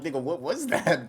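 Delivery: ordinary talk, nothing marked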